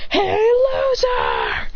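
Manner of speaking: high, stretched-out taunting holler; Sasuke. Acted. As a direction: drag out the mock yell way up high. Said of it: "your boy Sasuke yells".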